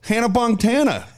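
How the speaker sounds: high indignant cry, imitating; mock outrage. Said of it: "he'd get so mad".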